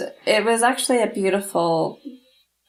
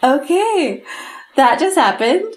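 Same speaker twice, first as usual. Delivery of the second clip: stunned amusement; 7 semitones higher, 6 dB louder than usual. Retold or imitated. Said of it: "I was like".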